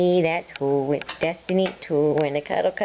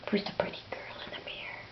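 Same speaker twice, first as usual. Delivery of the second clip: whisper; 15 dB quieter, faster than usual. Acted. Talking to bird at mirror.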